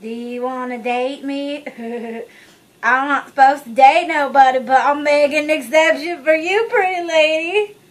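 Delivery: slow, leering creepy-guy voice; creepy Anakin.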